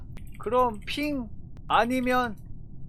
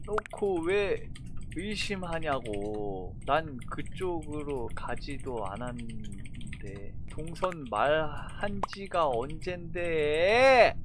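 sulky grumbling; 7 semitones lower, 8 dB quieter than usual. Real, not acted.

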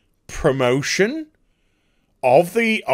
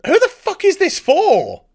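high, louder angry outcry; exasperated outburst; 12 semitones higher, 4 dB louder than usual. Real, not acted. To the viewers, a high furious outburst. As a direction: vent the outrage at the trailer.

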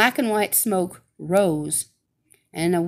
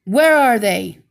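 a much louder snapped demand; 9 dB louder than usual. Acted. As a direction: demand it, loud and sharp. Witch demands missing slippers.

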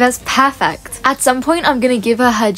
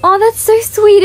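high, bright burst of delight; overjoyed when a player offers her pets; 10 semitones higher, 5 dB louder than usual. Real, not acted. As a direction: gush over the sweet gesture. Touched by a gift in game.

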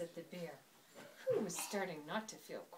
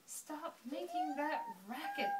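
higher-pitched bear complaint; mild bellow; 8 semitones higher than usual. A narrator's reading. Bear to the noisy animals outside, mild bellow. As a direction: read it as a bear calling out crossly. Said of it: "bellowed the bear".

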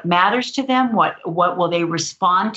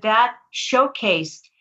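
normal talking voice

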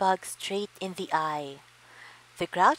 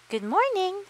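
friendly high voice; 13 semitones higher, 4 dB louder than usual. A narrator's reading. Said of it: "said the friendly ladybug".

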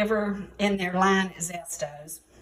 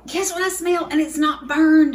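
excited; 6 semitones higher, 6 dB louder than usual. Real, not acted. Repeated excited question.

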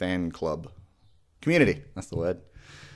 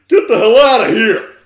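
much higher and louder outburst; sudden shout; 12 dB louder than usual. Acted. Goth author yells at readers who don't know [name], shouting. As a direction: blow up at the reader.

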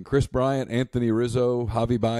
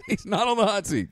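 laughing; 11 semitones higher than usual. Real, not acted. Laughs through repeated denial.

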